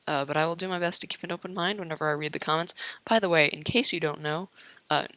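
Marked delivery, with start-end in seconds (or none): none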